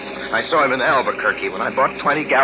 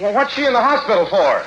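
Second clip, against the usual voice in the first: higher, louder worried question; alarmed; 8 semitones higher, 4 dB louder than usual. Acted. Son hearing his mother is hospitalised, alarmed. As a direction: press anxiously for answers.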